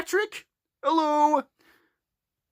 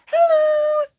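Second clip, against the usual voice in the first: much higher squeaky hello; tiny girl hello; 14 semitones higher, 4 dB louder than usual. Pretend. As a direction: chirp it up high. Cream says hello.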